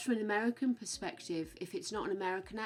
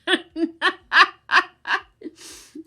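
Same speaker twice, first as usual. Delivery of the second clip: high, loud burst of laughter; 10 semitones higher, 14 dB louder than usual. Real, not acted. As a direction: crack up in a rapid, high cackle. Tickled by teasing about Taz.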